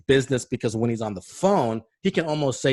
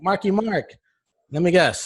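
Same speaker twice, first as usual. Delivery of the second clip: playful mock greeting; 8 semitones higher, 4 dB louder than usual. Imagined teasing greeting to celebrity.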